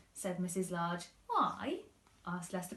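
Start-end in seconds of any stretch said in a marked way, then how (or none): 1.3-1.8 high little voice; child's why. Acted. Lester asking mum, high child voice. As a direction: ask it like a boy.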